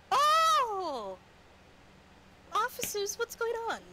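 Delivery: put-on high, surprised old lady. GM voices old Mrs Lewison.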